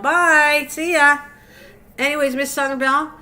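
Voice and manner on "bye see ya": higher, louder mock goodbye; viewers saying bye. Streamer imitating her viewers leaving, mock cheery goodbye. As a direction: mimic the viewers' loud send-off. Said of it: "Now they're going".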